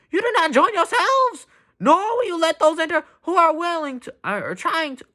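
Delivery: high, strained, cracking mock-dramatic shout; movie-style Jesus denouncing. Movie Jesus rebuking the Pharisees to their faces, hammed-up shout. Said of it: "how in movies they would depict this".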